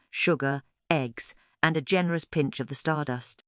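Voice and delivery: ordinary delivery, nothing marked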